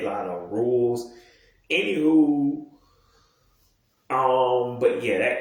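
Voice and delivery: ordinary talk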